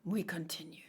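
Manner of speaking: unvoiced, hushed decision; whisper. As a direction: breathe it out quietly.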